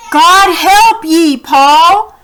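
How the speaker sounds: loud, slow called blessing; grandpa calling. Acted. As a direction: send it out over the water. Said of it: "His words carried out over the water".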